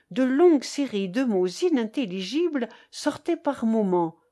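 plain reading voice

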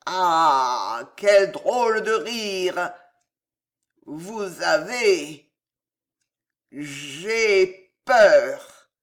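put-on slow halting drunken fear; tipsy, drawn-out dread; drunk Gillot, afraid. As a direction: falter between phrases, uneasy. Drunk nephew, frightened.

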